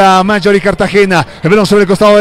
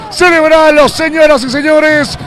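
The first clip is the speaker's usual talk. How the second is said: goal celebration; 7 semitones higher than usual. Real, not acted.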